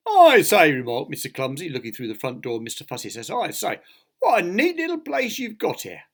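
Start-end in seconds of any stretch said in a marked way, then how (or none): 0.1-0.7 high, loud "I say"; impressed exclamation. A narrator's reading. Impressed visitor admiring the house. 3.3-6.0 high-pitched jolly gushing; impressed admiration. A narrator's reading. Impressed visitor admiring the house.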